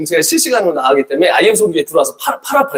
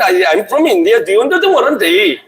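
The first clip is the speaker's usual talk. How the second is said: very slow English, a light Indian lilt; hammed-up Indian English with a laugh; mock Indian accent; 3 semitones higher, much slower than usual. Preacher imitating how Indian people talk, very slow mock Indian English. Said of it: "This is Indian people, they talk this, this way".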